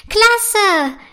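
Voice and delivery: high, loud cry of joy; loud cheer. A narrator's reading. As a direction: burst out with delight. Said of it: "jubelt Prinzessin Felicitas laut".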